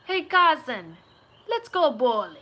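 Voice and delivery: loud New York Italian-American call; Italian-American accent. Shown as a demonstration. Inviting a cousin bowling, loud Italian-American accent. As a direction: holler to your cousin in Long Island Italian-American. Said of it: "I can do American Italian".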